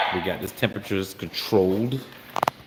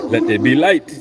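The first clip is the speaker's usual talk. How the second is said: grand proclamation; 5 semitones higher, 12 dB louder than usual. Pretend. Mock divine proclamation with flash.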